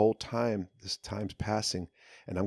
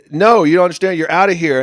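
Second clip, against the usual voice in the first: insistent guard; 10 semitones higher, 17 dB louder than usual. Retold or imitated.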